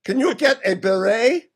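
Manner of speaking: put-on hammy French accent. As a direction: ask as a mock Frenchman. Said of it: "use a French accent".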